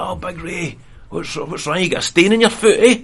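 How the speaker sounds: cheeky jokey greeting; mock cheery teasing. Teasing a limping scaffolder, high and cheeky. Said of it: "I was like".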